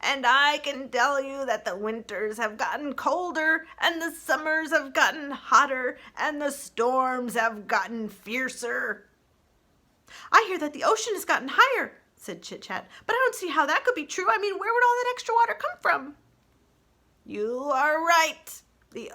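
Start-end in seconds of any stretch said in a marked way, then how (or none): 0.0-9.1 slow, higher, weighty ancient turtle; slow old turtle. Acted. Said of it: "spoke slowly". 10.3-12.0 put-on fast, high, louder young critter; fast, eager chatterbox; excited chatterbox. Chit-Chat character voice. 13.0-16.2 put-on fast, high, louder young critter; fast, eager chatterbox; excited chatterbox. Chit-Chat character voice.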